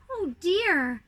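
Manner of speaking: plain reading voice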